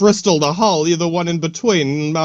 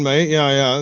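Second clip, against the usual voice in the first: usual speaking voice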